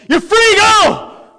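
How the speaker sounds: high, loud, slow command; guards' order. Retold or imitated. Soldiers opening the cell, high loud slow shout. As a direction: bark the release at the prisoner. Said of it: "and they said".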